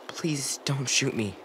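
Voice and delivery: slow, drawn-out begging; slow plea. Acted. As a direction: plead slowly, weighing each word. Repeated plea, slower take.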